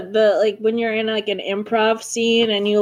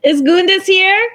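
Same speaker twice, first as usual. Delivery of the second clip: high, loud questioning call; 7 semitones higher, 6 dB louder than usual. Pretend. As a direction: call into the building, rising at the end. Calling out for someone at home.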